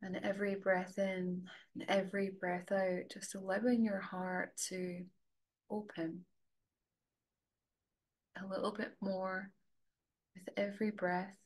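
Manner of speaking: soft, level voice with long pauses; guided meditation. Real, not acted. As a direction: gently guide them inward, leaving space to breathe.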